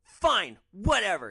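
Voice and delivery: loud dismissive outburst; yelling. Retold or imitated. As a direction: storm off shouting. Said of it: "And then he just yells".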